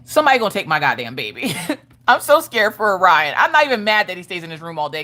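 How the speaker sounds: normal talking voice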